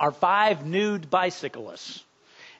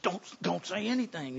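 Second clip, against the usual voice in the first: hushed; 10 dB quieter than usual. Retold or imitated. Father hushing wife near nude cyclists.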